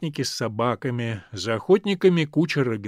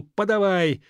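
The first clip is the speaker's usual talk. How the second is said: higher, raised call to the coachman; narrated shout; 8 semitones higher, 4 dB louder than usual. A narrator's reading. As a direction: call out the order, not at full volume. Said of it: "крикнул". (выкрик-команда)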